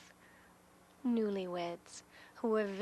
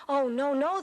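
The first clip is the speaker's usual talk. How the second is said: louder, higher protest; husband objecting; 6 semitones higher, 8 dB louder than usual. Acted. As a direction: stop her firmly. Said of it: "the husband said".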